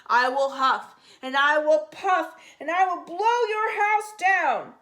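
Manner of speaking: loud, raging threat; the furious shark threatens to blow the house down. A narrator's reading. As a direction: bellow the threat in fury.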